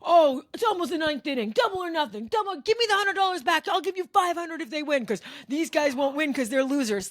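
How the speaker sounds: put-on high, pleading, pushy drunk voice; high wheedling drunk bargaining; wheedling heckler. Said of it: "So then he's like".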